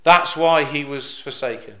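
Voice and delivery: ordinary talk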